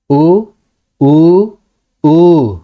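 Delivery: three separate उ sounds, each held longer, ending on falling ऊ; three vowel lengths. Shown as a demonstration. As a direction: stretch from short उ to prolonged ऊ. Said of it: "इन तीनों उ में".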